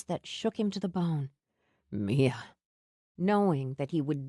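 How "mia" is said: Sam's voice, lower, tender; a lover saying her name low; low murmur of her name. A narrator's reading.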